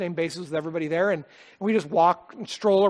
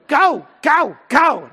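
loud, high insistent go go go; imitating his daughter insisting they leave; demanding child; 6 semitones higher, 8 dB louder, much slower than usual. Father voices his daughter demanding they go home, loud and high.

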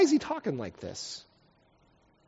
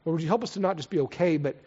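prayer; 4 semitones lower than usual. Real, not acted. Leads the congregation in prayer.

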